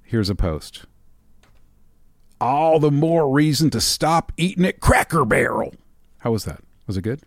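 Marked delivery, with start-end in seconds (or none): 2.4-5.7 a hint of an offended Southern commenter; mock Southern accent. Podcast host reading a post aloud, lightly mock Southern accent. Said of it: "offensive Southern accents".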